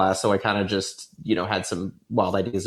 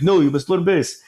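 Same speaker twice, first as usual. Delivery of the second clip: put-on higher crappy Arabic accent; louder bad Arabic dad voice; 7 semitones higher, 4 dB louder than usual. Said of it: "my really crappy, you know, Arabic accent".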